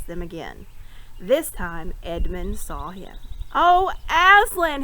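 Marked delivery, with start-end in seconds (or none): 3.5-4.8 high, loud, slow joyful cry; cried out. A narrator's reading. Edmund seeing Aslan at last, crying out high and loud. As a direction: cry out to him in wonder. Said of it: "he cried".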